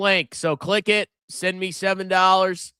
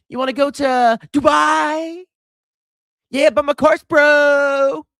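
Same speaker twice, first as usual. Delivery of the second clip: much higher, hyped-up get-rich guru voice; mocking a hype guru; 8 semitones higher than usual. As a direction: sell the Lambo dream like a scammy influencer. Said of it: "my message is boring".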